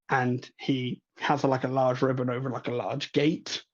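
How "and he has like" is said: normal talking voice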